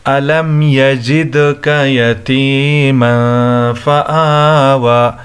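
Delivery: slow, melodic tajweed recitation; drawn-out measured chanting; Quran recitation. Shown as a demonstration. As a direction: recite with held vowels and bounced d.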